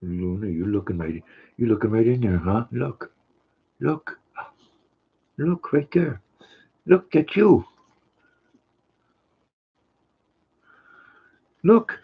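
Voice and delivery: cooing, rising sing-song at the dog. Real, not acted. Owner urging her dog Luna to look, high cooing baby talk.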